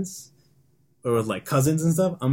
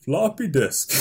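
movie trailer voice; 4 semitones higher, slower than usual. Shown as a demonstration. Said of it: "How would you introduce the movie".

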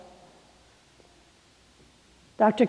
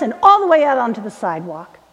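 ordinary talk, nothing marked

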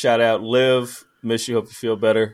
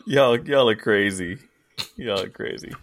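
ordinary delivery

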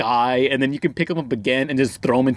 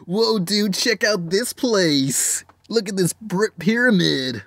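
higher, slow, drawn-out dude voice; super nineties kid; 6 semitones higher, slower than usual. Retold or imitated. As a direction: imitate an awestruck nineties kid. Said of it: "super nineties kid saying".

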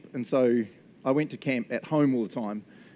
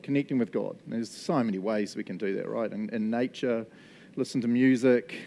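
ordinary delivery

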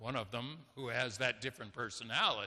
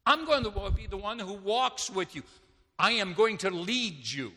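preaching at full force; 7 semitones higher, 5 dB louder than usual. Acted. Pastor proclaiming the shepherd's care to the congregation, in a loud preaching voice. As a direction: drive the point home from the pulpit.

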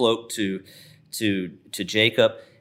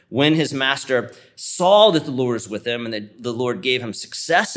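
scripture reading; 3 semitones higher, 3 dB louder than usual. Acted. Reads Genesis 39 aloud.

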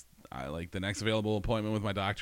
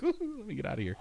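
high, eager escape line; high gleeful rush; excited; 14 semitones higher than usual. Retold or imitated. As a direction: squeal it with glee. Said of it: "I was just like".

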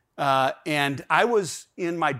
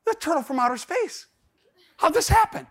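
high-pitched comic disbelief; stunned bafflement; 12 semitones higher than usual. Acted. Dad stunned by a turtle in his yard, baffled. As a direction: squeak it out in mock astonishment.

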